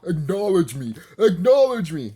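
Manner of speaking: loud, commanding acknowledge me; Roman Reigns demand. Retold or imitated. Mocking a fan as Roman Reigns, booming demand. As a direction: order them to acknowledge you like a wrestler. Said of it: "What are you, Roman Reigns now?".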